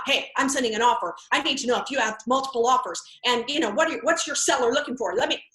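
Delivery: the curt agent he is not, fast and pushy; brisk businesslike agent. Acted. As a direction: rattle it off like a busy agent. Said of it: "I'm not".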